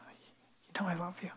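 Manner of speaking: slow, barely audible whisper; subdued answer. Acted. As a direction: answer very softly and haltingly. Peter's subdued first answer to Jesus.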